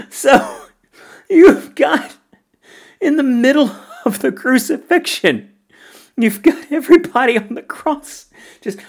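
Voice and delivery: high-pitched, helpless real laughter through the words; laughing while talking. Real, not acted. Film fan describing an absurd song scene, laughing. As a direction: tell it while cracking up.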